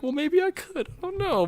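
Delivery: very high, wavering-in-opinion reply; playful self-doubt. Retold or imitated. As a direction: answer yourself in a lifted, unsure voice. Voices own unsure inner answer.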